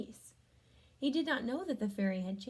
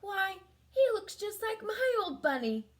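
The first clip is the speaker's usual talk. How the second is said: put-on boy's voice; 9 semitones higher, 5 dB louder than usual. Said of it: "the boy thought".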